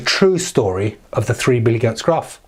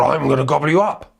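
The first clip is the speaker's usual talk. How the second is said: troll voice. Acted. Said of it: "and the troll said".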